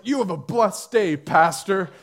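a hint of put-on piety, pushed out loud; suddenly religious cashier. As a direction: send up an over-religious goodbye. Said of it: "people become religious".

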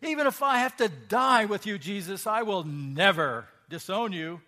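loud, high, insistent vow; emphatic insistence. Acted. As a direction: swear it, refusing to be doubted. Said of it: "Peter insisted emphatically".

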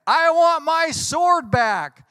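slow, high retold shout; imitated yell. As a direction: bellow it like an angry man at the door. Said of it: "yelling in the neighborhood".

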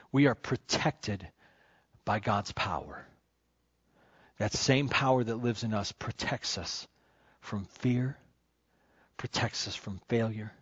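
preacher calming the room after the climax; hushed preaching. Acted. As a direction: bring it down, tender and intimate.

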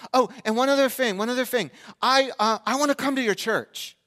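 put-on high, loud, eager boy voice; neighbor kid. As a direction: imitate an excited kid piping up. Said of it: "He says".

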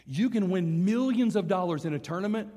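emphatic repeat. Real, not acted. Preacher warns church about settling for safety, emphatic.

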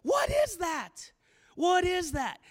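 high, drawn-out shouts of alarm; frightened crying out. Acted. Disciples see a ghost on the water, frightened shouts. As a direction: cry it out loud in terror. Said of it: "they cry out like".

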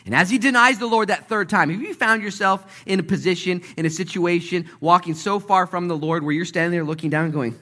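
high, loud, driving sermon delivery; full-force preaching. Real, not acted.

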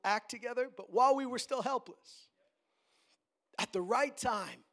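firm, weighty reading of the verse; emphatic scripture preaching. Real, not acted. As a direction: proclaim the verse to the congregation.

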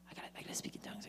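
anxious whispered repetition; whispering. Acted. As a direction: whisper it like a nervous seeker. Anxious seeker at the altar.

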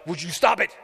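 loud, exasperated outburst; irritated snap. Acted. Disciples fed up with the woman, acted loud snap. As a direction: turn round and snap at her.

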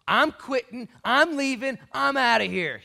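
loud, high, defiant declarations; acted quitting. Disciples bouncing out of Jerusalem, loudly fed up. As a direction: punch out each announcement at high volume. Acting disciples who bail out.

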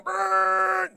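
high buzzing foghorn noise; foghorn blast. Retold or imitated. People outside cheering with a foghorn, buzzing blast.

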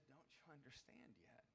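soft whispered question. Acted. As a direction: breathe the question almost silently. Jesus asks disciples quietly.

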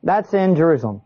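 ordinary talk, nothing marked

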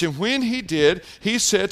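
ordinary talk

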